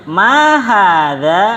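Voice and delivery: MA drawn out and arched high, HADZA rising at the end; slow, stretched question tune on "Ma hadza"; question intonation. Shown as a demonstration. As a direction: say "Ma hadza" very slowly as a lilting question. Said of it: "dibedakan intonasi pertanyaan".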